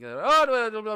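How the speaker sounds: high, loud burst of babbled blah-blahs; fast gibberish imitation. Traveler imitating fast-talking Irish people, rapid gibberish. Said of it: "They talk so fast".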